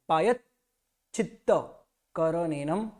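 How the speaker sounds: पायच्छित्तकरणेनम very slow, half च after य; पायच्छित्तकरणेनम syllable by syllable; slow clear पायच्छित्तकरणेनम; careful recitation. Shown as a demonstration. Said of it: "य के साथ में आधा च बोलता हूँ".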